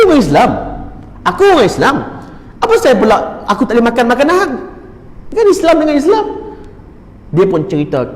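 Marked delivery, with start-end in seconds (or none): 0.1-6.3 high-pitched, indignant comeback; acted affronted argument, voice raised high; retold indignant objection. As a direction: protest as if wrongly refused. Husband argues back at the refusal.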